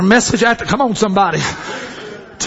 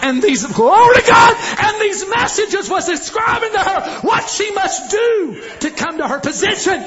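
full-force preaching; 9 semitones higher than usual. Acted. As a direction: lift it up and shout to the church.

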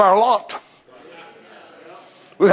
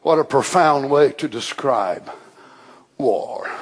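normal talking voice

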